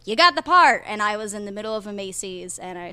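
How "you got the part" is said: louder, higher quoted announcement; quoting an excited casting call; excited good-news announcement. Retold or imitated. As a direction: deliver the big news with a burst of energy.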